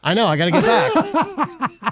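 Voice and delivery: high, drawn-out playful fluster; mock panic. Builder joking back to host, mock panic. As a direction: play along as if late for work.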